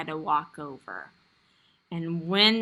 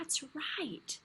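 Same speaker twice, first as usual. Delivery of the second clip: soft unvoiced "that's right"; whispered praise; 5 semitones higher, 15 dB quieter than usual. Real, not acted. Teacher praises softly.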